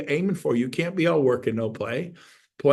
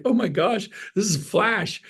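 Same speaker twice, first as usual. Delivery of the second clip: higher-pitched mock amazement; amazed wife; 6 semitones higher than usual. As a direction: play an astonished, delighted wife. Voices the delighted, amazed wife.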